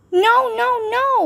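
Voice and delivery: put-on very high, loud toddler wail. A narrator's reading. Kalia wails reaching for Bean, very high. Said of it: "wailed Kalia".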